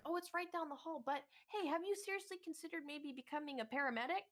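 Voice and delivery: high-pitched, oblivious mom voice; imitated chatty mom. Mom detains guest with career advice, high chatty voice.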